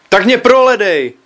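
put-on high, loud teenage shriek. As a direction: screech it out in defiance at the father. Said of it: "zaječel". (vysoký hlasitý vzdorovitý jekot)

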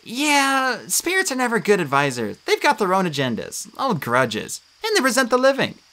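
a lightly voiced worried girl; Annabeth's voice. A narrator's reading. Narrator voicing Annabeth fretting over the dead.

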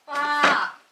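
loud drawn-out call to another room. Real, not acted. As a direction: call out to someone far away. Calling brother from another room.

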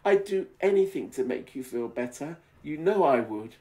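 hammed-up sincere vow; Glenn pleading. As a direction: promise anything to comfort. Glenn comforting her.

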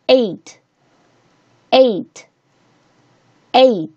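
eight with a clear ay glide; diphthong A. Shown as a demonstration. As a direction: say eight slowly, spaced, ay then t. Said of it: "只发一个双元音A".

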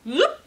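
short, high, loud zoop; zoop sound effect. A narrator's reading. The Replicator copies Alpaca, sudden rising zoop. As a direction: pop out a rising machine noise. Machine noise in the story.

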